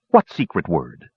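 ordinary delivery, nothing marked

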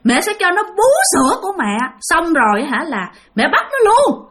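put-on high, creepy mother plotting with her child; ghost mother's voice. Ghost mother telling her child she will seize the man, high-pitched and creepy. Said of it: "Đó, bà nói với con bả".

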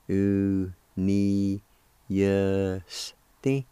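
"U, ni, yes, ti", each syllable long and separate. Shown as a demonstration. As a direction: draw out "U ... ni ... yes ... ti" evenly. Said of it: "Second part".

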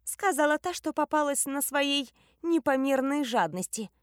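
high-pitched snappy comeback; small character's indignant retort; snappish retort. A narrator's reading. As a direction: snap back in a high little voice. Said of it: "огрызнулась Нани".

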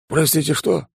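high startled pilot voice; nervous bewildered question; confused nervous pilot. Acted. Bond confused.

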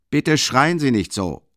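loud, high, slow rebuke; student angrily telling the lieutenant to stop shouting; slow angry reproof. A narrator's reading. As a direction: push each word at him. Quarrel in police office.

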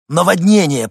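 loud, high, rasped warning; hoarse cry. A narrator's reading. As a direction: rasp out the alarm at full voice. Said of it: "прохрипел Винсент". (хриплый тревожный крик)

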